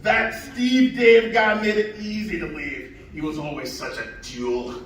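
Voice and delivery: high Scottish brogue; thick Scottish accent, storytelling. Acted. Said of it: "his thickest Scottish brogue".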